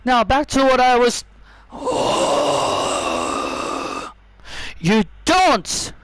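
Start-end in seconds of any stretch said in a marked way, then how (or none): 1.7-4.1 deep long startled gasp; big hammed-up gasp; shocked gasp. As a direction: gasp in outrage. Shocked reaction.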